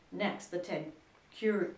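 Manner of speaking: plain reading voice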